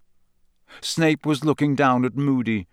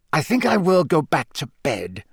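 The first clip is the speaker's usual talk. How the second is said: stiff, curt withdrawal; 3 semitones higher than usual. A narrator's reading. Snape backing down before Moody, curt. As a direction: end the argument coolly. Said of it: "Snape said curtly".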